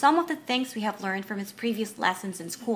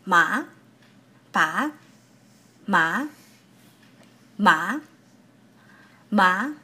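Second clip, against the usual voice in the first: second-tone "ma", swooping upward; rising tone; 2 semitones lower, much slower than usual. Shown as a demonstration. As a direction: lift each "ma" and "pa" like calling mom for something. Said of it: "like when you call your mom".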